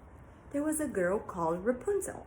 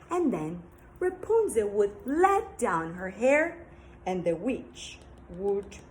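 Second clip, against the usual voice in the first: ordinary delivery, nothing marked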